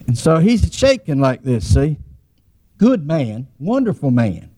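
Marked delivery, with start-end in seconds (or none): none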